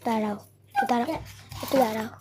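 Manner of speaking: usual speaking voice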